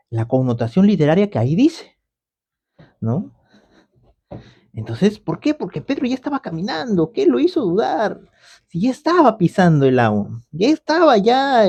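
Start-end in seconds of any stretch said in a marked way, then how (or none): 5.5-11.6 high, questioning disbelief; rhetorical wonder. Acted. Preacher puzzling over Peter's doubt, high and amazed. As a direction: ask it amazed.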